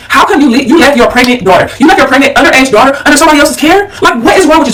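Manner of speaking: high-pitched, disbelieving telling-off; indignant scolding. Retold or imitated. Daughter calling mother about abandoned pregnant sister, indignant. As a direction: act out confronting someone, hitting each accusation.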